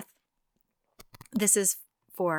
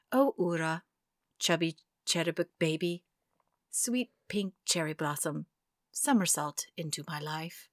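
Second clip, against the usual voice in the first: soft, sparse haiku reading; hushed sparse haiku; 2 semitones lower, 5 dB quieter than usual. Acted. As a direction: let each image land quietly. This is love for Ura.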